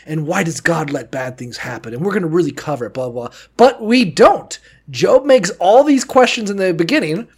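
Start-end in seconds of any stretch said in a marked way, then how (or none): 0.1-3.4 softer, mock-lecturing prior voice; mild mock lecture voice, softer; mocking Bible professor. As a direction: parody a droning scholar, quiet. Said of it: "it's like".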